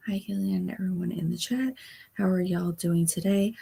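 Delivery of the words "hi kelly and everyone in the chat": softly whispered greeting. Real, not acted. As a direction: whisper hello to the chat. Greeting chat quietly.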